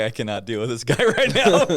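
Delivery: amused, laughing at his own reaction; laughing exasperation. Retold or imitated. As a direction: chuckle while brushing the guy off. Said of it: "I'm like".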